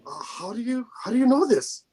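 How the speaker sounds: high-pitched, bewildered retold question; imitating baffled prisoner. Prisoner questioning his all-knowing interrogator, high and baffled. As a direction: act out a prisoner thrown by what he hears.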